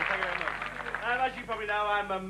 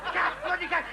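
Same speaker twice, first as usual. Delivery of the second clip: higher, shouting cack cack; ranting refrain; 7 semitones higher than usual. Acted. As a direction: bark it in disgust.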